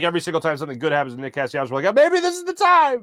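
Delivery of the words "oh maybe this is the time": high, louder, hopeful outcry; imitating over-hopeful fans in a high voice; mock hopeful fans. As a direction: cry it out like fans getting their hopes up. Hopeful fans' cry, acted.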